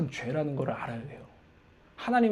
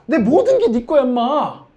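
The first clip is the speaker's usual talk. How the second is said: very high, loud outburst; exasperated father; 7 semitones higher, 8 dB louder, slower than usual. Acted. Father insisting to his elder son, exasperated. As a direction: shout it in loving frustration. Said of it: "아버지가 답답해서".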